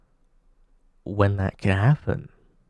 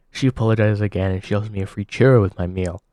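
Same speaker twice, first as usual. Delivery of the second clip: normal talking voice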